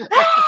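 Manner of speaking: high, loud imitated kid scream; sudden high "Ah!" yell; mock scream. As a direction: scream like a lunging toddler. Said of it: "like, screams at my face".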